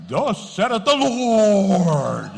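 loud, drawn-out mock preacher; pulpit voice. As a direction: boom it out hugely slowly, parodying a pulpit. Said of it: "you ought to get a pulpit voice".

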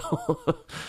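a sudden high squeak of a laugh. Real, not acted. Laughing at the girlfriend's mixed-up clothes, a sudden high laugh. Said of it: "I'm just laughing".

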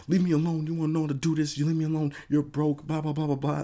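high, loud, hammed-up defensive outburst; mock defensive rant. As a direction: mimic a celebrity snapping back at critics. Mocking an overly defensive celebrity.

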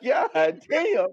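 high, strained outcry; exasperated exclamation. Retold or imitated. Traveler reacting to getting cussed out, exasperated. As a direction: cry out in disbelief.

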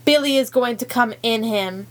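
usual speaking voice

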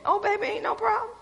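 high, quick, playful assent; breezy sassy reply. Acted. Woman happily sharing her man, high and breezy.

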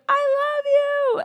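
high, drawn-out playful yell; imitated loud declaration. Speaker starting a prayer to God, mock yell. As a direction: holler it high and slow. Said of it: "I said it so loud".

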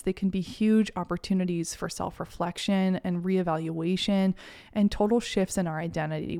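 normal talking voice

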